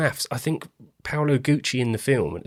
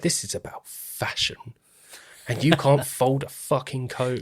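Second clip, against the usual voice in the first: mock exasperation; 5 semitones higher than usual. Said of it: "And I'm like".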